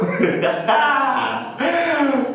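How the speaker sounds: high-pitched burst of laughter; delighted laugh. Real, not acted. Delighted at the come-in and income pun, high laughter.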